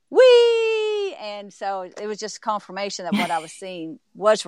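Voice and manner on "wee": high, loud, sustained squeal; mock yell, pitched way up; retold child's yell. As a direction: imitate a girl being tossed in the air. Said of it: "the girl would yell".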